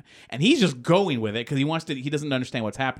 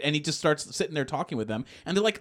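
ordinary talk

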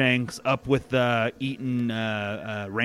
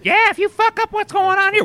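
much higher echoing goblin voice; squeaky second goblin; 7 dB louder than usual. Acted. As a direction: pipe up like the tiny goblin. Second goblin echoes the first.

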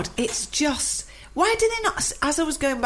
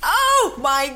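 laughing; 11 semitones higher than usual. Real, not acted. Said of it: "It's brought tears to my eyes".

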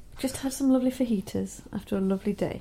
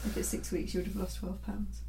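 near-whispered, much softer; quiet aside; 3 semitones lower, 9 dB quieter than usual. Real, not acted. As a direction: drop almost to a whisper. Quiet aside during weight sums.

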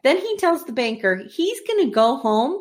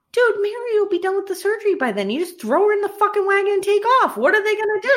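exasperated rant; 9 semitones higher, faster than usual. Real, not acted. Host ranting at the plan.

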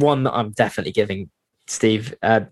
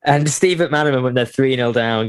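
ordinary talk, nothing marked

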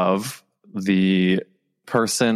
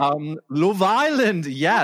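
put-on British accent; 8 semitones higher, slower than usual. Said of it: "I do a really bad accent".

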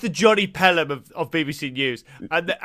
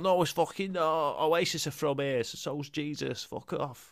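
mock Manchester troll; 9 dB quieter than usual. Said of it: "saying things like".

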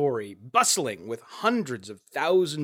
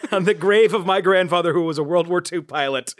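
ordinary talk, nothing marked

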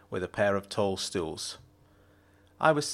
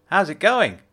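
Jenna's voice; 8 semitones higher, 7 dB louder than usual. A narrator's reading. Jenna voiced by male narrator.

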